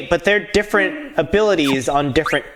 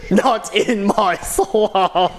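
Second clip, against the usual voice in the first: very drawn-out Scottish ogre line; Shrek; 4 semitones higher, much slower than usual. Acted. Streamer tacks on the ogre's catchphrase, slow hammy Scottish accent. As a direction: stretch every word in mock Scottish. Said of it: "Scottish accent".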